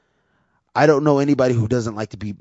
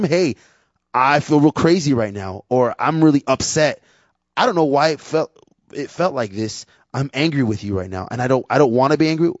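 upset venting; 4 semitones higher, 6 dB louder than usual. Pretend. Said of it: "you can tell him".

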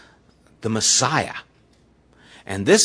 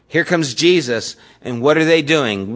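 normal talking voice

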